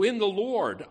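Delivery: emphatic preacher's "In the Lord"; stress on In the Lord. Shown as a demonstration. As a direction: land hard on "In the Lord", then drop. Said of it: "those first few words are there for emphasis".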